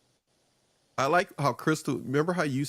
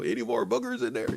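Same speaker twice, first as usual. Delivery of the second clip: put-on much higher, fussing baby-talk question; doting parent; 10 semitones higher than usual. Parent checking on a crying toddler, high coaxing voice. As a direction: tease like a parent wiping a little nose.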